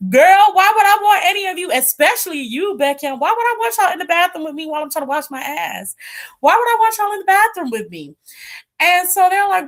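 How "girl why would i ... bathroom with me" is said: sassy retort. Retold or imitated. [name] to [name], high indignant sass.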